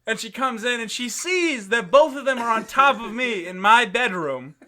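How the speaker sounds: usual speaking voice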